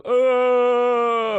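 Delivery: long, high, loud mocking wail; Buffalo Bill. Comic re-enacting the pit scene, long mocking wail. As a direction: imitate a captor aping his victim's scream. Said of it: "Buffalo Bill starts going".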